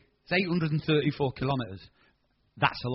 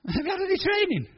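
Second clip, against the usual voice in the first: much higher sceptical question; incredulous official; 13 semitones higher than usual. Retold or imitated. Official doubting the old man, high pitched. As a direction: ask it in disbelief.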